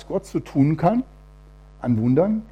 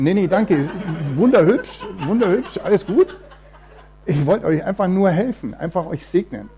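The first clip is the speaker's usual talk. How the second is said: laughing refusal; 5 semitones higher, 5 dB louder than usual. Retold or imitated. Retold refusal of offered daughter.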